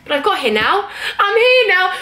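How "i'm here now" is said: high, louder burst of relief; relieved exclamation. Real, not acted. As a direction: announce it like you finally made it. Relief after chaotic journey.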